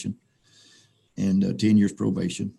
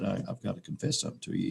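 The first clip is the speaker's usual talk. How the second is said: softer, hurried confession; hushed confession; 11 dB quieter, faster than usual. Retold or imitated. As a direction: rush it out under the breath. Kneeling man confessing.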